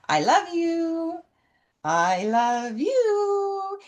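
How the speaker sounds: tender long-held call; loving call. Acted. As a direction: croon it slowly to a beloved horse across a field. Calling lovingly to horses in field.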